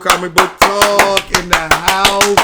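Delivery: loud, slow host intro; show intro. Acted. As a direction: announce the show with energy. Podcast intro call-out.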